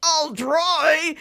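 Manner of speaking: strained cartoon grunt; grunting, breath held. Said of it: "grunting, holding his breath".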